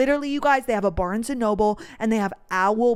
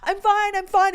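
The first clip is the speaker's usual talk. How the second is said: high-pitched insistence, imitating a tearful self; mock-tearful, high reassurance; retold sobbing; 10 semitones higher, 4 dB louder than usual. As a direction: act out brushing off your own tears. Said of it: "I'm sobbing".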